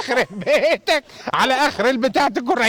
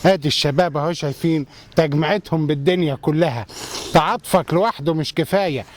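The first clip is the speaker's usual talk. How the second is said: ad read; 7 semitones lower than usual. Acted. Reading ad copy.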